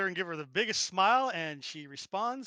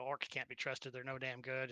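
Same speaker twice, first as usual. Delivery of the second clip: much softer, lower grumbling; low grumble; 7 semitones lower, 13 dB quieter than usual. Acted. Old lady writes off the orcs, low soft grumble. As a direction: grumble it under your breath.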